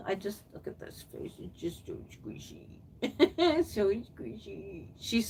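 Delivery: soft doting whisper; cooing whisper. Real, not acted. Cooing over a reborn doll.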